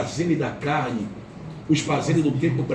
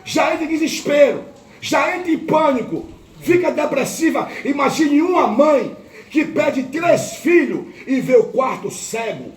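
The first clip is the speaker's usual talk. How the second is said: high, slow, swelling preacher's voice; preacher pleading for the grieving mother; impassioned preaching; 9 semitones higher, slower than usual. Acted. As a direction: drive each phrase home to the church.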